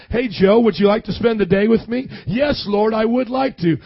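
usual speaking voice

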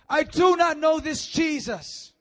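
high, loud, slow word by word; Peter's last furious denial; angry slow denial. Acted. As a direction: deny it with full fury.

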